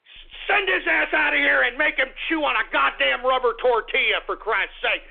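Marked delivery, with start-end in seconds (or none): none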